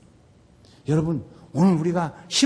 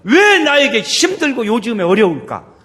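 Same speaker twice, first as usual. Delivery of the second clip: loud, drawn-out, raised why; impassioned questioning; 6 semitones higher, 12 dB louder, slower than usual. Acted. Preacher voices a believer asking why life is hard, loud drawn-out cry.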